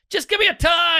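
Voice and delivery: high, frustrated whine; exasperated plea. Retold or imitated. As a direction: beg for a straight answer. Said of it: "and you're like".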